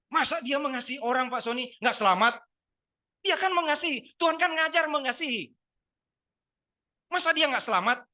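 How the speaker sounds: loud, high, driving sermon voice; full-force preaching. Acted. Pastor pressing the congregation, loud preaching. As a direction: preach it hard to the room.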